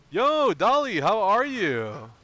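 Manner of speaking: high, loud, drawn-out hello; excited greeting. Real, not acted. Streamer welcoming Dolly to the stream, excited. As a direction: sing out a big welcome.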